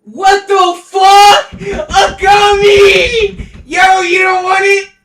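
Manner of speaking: high, loud, slow stunned yell; real hype over a rare find; excited shout. Real, not acted. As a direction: drag it out in disbelief. Finds gummy, yelling.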